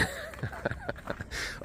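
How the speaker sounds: a burst of high breathy laughter; high squeaky laugh. Real, not acted. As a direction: laugh at your own joke. Laughing at own impression.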